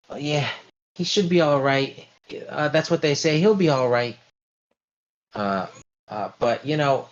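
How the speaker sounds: a hint of a worried matron; lightly voiced fretful older lady. GM holds Mrs Lombardi figure.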